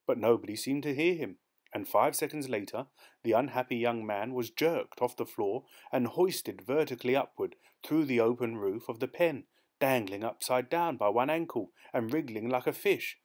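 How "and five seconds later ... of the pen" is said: usual speaking voice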